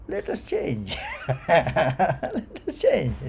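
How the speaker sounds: words breaking up into laughter; laughing. Acted. Teacher joking about changing a worn shirt, laughing. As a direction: say it through a real, amused laugh.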